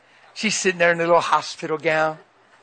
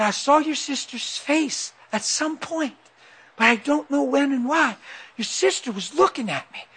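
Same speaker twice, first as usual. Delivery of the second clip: high, softer voice of the wife; imitated wife; 7 semitones higher, 4 dB quieter than usual. Wife recalls waking to her husband, imitated high soft voice. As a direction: mimic her, pitched up and soft.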